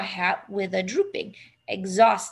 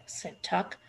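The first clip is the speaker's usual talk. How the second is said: ordinary delivery, nothing marked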